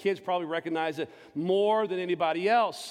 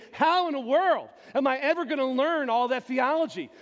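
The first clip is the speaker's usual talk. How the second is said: higher, louder, panicky self-doubt; anxious inner voice; 8 semitones higher, 5 dB louder than usual. Retold or imitated. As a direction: replay the panic of a scared teenager. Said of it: "And I'm thinking".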